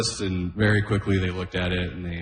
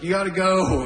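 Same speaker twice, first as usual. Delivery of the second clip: parent nagging; 10 semitones higher than usual. Retold or imitated. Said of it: "Be like".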